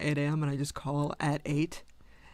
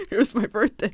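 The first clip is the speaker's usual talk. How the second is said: higher and louder, laughing through a mock recital; 5 semitones higher, 6 dB louder than usual. Caller laughingly mocking her own routine details. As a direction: giggle out the details.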